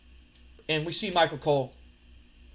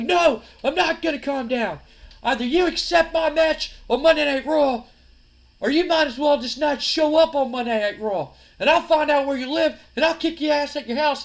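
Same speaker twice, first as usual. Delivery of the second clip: high, loud defiant threat; angry defiance; 9 semitones higher, 6 dB louder than usual. Acted. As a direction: refuse to back down and threaten loudly. Acted wrestler refusing and threatening.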